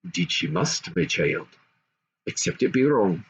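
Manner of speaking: grave king. A narrator's reading. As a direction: answer his daughter gently but firmly. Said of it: "said the king".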